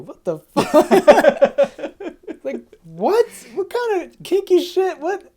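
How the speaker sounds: high-pitched mock disbelief, breaking into laughter; incredulous outburst. Acting out Vegeta learning Goku never kisses, incredulous outburst. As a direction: squeal the questions through laughs. Vegeta's shock at the no-kiss reveal.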